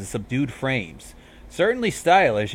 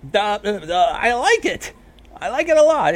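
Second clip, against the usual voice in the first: flustered; 10 semitones higher, 5 dB louder than usual. Acted. Flustered forced approval.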